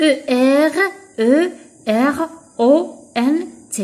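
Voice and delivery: letters spelt one by one with pauses; teacher spelling the ending. Shown as a demonstration. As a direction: spell each letter separately. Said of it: "spells ending letter by letter".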